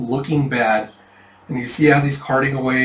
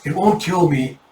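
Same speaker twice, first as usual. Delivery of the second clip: shivering; 5 semitones higher than usual. Acted. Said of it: "she's just, like, shivering".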